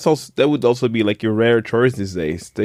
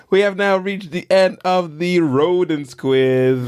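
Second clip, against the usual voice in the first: quiz host announcement; 6 semitones higher than usual. Acted.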